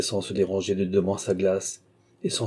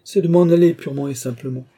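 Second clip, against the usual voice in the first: high, loud, decisive declaration; emphatic announcement; 4 semitones higher, 6 dB louder than usual. Acted. Countess tells the king she will leave, emphatic. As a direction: announce it with a dramatic flourish.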